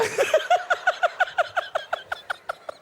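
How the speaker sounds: breathy high-pitched giggle; high laugh. Real, not acted. Preacher cracking up at his own story, high laugh. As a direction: burst into a helpless laugh.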